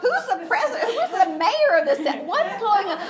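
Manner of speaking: high, loud, laughing panicked questions; hammed-up panic hunting for the man in charge; frantic asking. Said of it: "grabbing some men saying".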